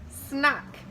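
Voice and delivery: ordinary delivery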